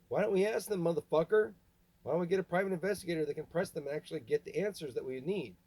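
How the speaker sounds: yelling in real frustration; angry shouting. Real, not acted. Furious rant at dad.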